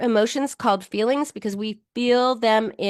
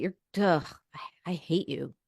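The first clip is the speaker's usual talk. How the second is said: disgusted self-hate; 4 semitones lower, 9 dB quieter than usual. Shown as a demonstration. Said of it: "Horrible, right?".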